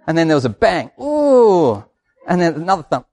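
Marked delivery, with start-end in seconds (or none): 1.0-1.8 high, drawn-out "ooh" of wonder; hammed-up spectator awe; imitating awed radio bloke. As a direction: coo at a firework bursting overhead. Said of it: "the bloke on the radio goes".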